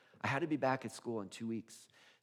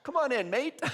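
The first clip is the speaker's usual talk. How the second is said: higher, louder, laughing mate; laughing Aussie welcome; 12 semitones higher, 7 dB louder than usual. Retold or imitated. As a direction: welcome him in through a laugh. Australian friend welcomes, laughing.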